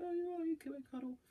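very high, soft, cooing falsetto; sweet missus voice. Retold or imitated. Wife gently inviting him for a cuddle, high soft coo. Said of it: "she didn't say it like that".

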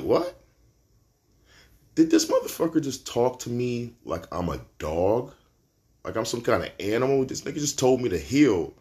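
high, loud, indignant burst of disbelief; can't believe he was just treated like a dog; outraged disbelief. Retold or imitated. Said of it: "And I'm like".

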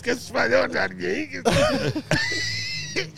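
high shrill mock wife; wife scolding. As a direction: squeak it like the wife catching you. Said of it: "elle dit".

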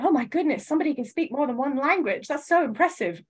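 high, gushing British wonder; mock amazement. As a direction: overdo the impressed gasp. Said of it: "me being like".

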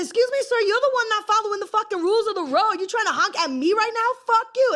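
high, loud indignant telling-off; retold angry yelling. Yelling at the rule-breaking driver, reenacted furious and high. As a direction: scold the honking driver from the truck window. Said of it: "I say".

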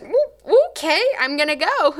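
high surprised woo, then quick agreement; startled woo. Retold or imitated. Answering the Lord's sudden command, high startled woo. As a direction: jump at the call and give in.

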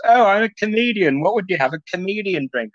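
high, mocking obnoxious voice; obnoxious kid. Obnoxious kid quizzing a comedian on the drinks cart, mocking high voice. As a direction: imitate an obnoxious kid, pitched well up. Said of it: "he's, like, really obnoxious".

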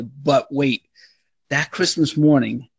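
usual speaking voice

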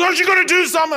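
high, loud demand; impatient boss shout. Retold or imitated. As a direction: bark it like an impatient officer. And he goes.

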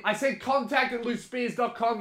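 high, put-on indignant voice; mock exasperated repeat. Pretend quarrel, repeating the address.

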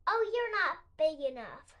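loud, dismissive little bug; grouchy ladybug. A narrator's reading. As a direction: brush them off with a sneer. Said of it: "said the grouchy ladybug".

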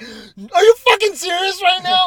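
high, loud, drawn-out disbelief; shocked outburst. Retold or imitated. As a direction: re-enact an outraged, stunned yell. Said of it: "I was shook".

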